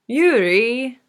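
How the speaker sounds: high, dragged-out sing-song name call. A narrator's reading. Two friends exclaiming Yuri's name together, sing-song. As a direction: drag out both syllables in a rosy lilt. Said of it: "dragging out the syllables of Yuri's name in the exact same sing-song rosy tone".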